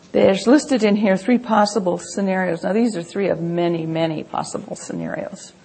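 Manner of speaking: ordinary delivery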